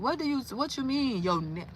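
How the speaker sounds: ordinary delivery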